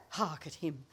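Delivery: ordinary talk, nothing marked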